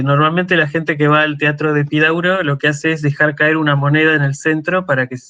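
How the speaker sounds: ordinary talk, nothing marked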